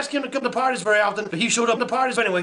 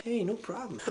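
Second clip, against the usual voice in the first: very soft, lower cloud voice; soft-spoken cloud; 4 semitones lower, 14 dB quieter than usual. Acted. Cloud replying to the grateful bears, very softly. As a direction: answer in a tiny hushed voice. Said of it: "And Cloud was like".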